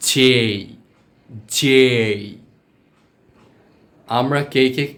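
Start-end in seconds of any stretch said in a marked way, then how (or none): 0.1-1.8 "জে" held long on a full vowel glide; isolated, drawn-out "জে" for learners; corrected letter J. Shown as a demonstration. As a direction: stretch "জে" out carefully as a model. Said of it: "কিন্তু এটা হবে".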